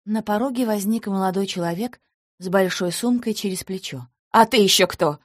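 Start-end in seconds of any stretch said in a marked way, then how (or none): 4.3-5.2 shouting. A narrator's reading. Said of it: "закричала обманутая жена".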